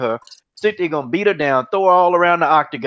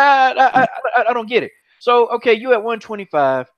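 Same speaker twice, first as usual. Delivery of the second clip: ordinary delivery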